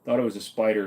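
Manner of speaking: ordinary delivery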